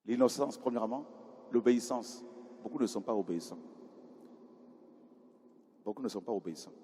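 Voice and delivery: ordinary talk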